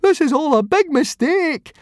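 high, loud pleading wail. A narrator's reading. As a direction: cry out in panic. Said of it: "wailed Fishlegs".

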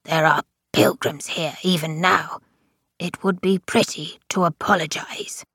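hoarse stage whisper, lightly voiced. A narrator's reading. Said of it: "in a hoarse and patently audible whisper".

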